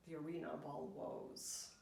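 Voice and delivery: ordinary delivery, nothing marked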